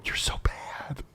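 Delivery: hushed whisper behind a covered mouthpiece; muffled aside. Retold or imitated. Prankster's accomplice covering the phone mouthpiece, muffled whisper. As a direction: whisper it on the sly, imitating an accomplice. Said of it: "someone else on the phone covering their mouthpiece".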